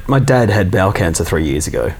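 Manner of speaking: ordinary delivery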